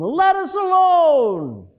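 high, slow, drawn-out demon cry. Acted. Preacher reading the demon crying out, high and drawn out. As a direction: stretch out the demon's plea, high and loud. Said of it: "he cried out".

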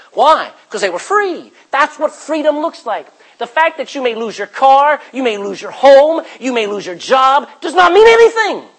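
high, loud rising preacher's cry; preaching at full force. Acted. Preacher building to a loud high climax for the church. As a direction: build the congregation up to a shout.

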